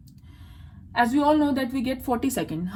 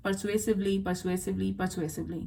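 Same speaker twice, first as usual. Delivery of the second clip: soft, lowered rote repetition; repeating to oneself; 3 semitones lower, 7 dB quieter than usual. Shown as a demonstration. Test-taker rehearsing a hard word in her head, quiet repetition. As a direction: say it over as if memorising, dropping softer each time. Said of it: "पांच बार अपने दिमाग में पढ़ती थी".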